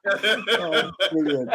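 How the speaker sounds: high, real laugh running through the words; laughing approval. Real, not acted. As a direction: say it while still cracking up. Recovering from laughing at joke.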